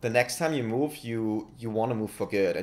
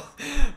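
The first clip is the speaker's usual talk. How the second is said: short burst of laughter; 9 dB louder than usual. Real, not acted. Laughs at own tangent.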